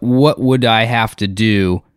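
ordinary talk

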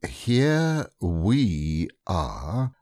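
slow, careful, drawn out; very slowly and carefully. A narrator's reading. Rabbit lays out the situation to friends, very slowly. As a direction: spell it out slowly like a careful planner. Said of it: "very slowly and carefully".

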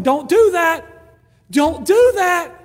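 mock divine scolding; firm warning. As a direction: scold with growing force. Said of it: "God's sitting there going".

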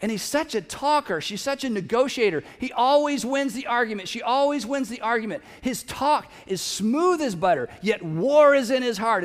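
ordinary delivery

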